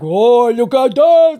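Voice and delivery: slow, loud imitation of a French accent; child's copied French accent, hammed up; mock French accent. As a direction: exaggerate a thick French accent, drawing it out. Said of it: "my husband's accent".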